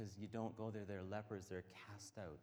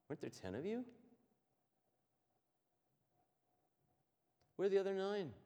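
rising to a louder, higher question; pointed questioning; 10 semitones higher, 7 dB louder, faster than usual. Retold or imitated. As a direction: ask where the others went. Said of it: "So Jesus looks at him and said".

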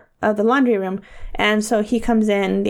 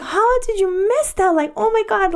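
mock scream; 11 semitones higher than usual. Retelling screaming at Kyle coming up the stairs, mock scream. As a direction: imitate screeching in disbelief, pitched way up. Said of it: "screaming up the, to, coming up the stairs".

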